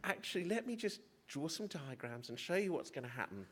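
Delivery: calm a frightened patient gently; reassuring. Retold or imitated. Junior doctor reassures a terrified patient, high and soothing. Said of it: "And my job was to say".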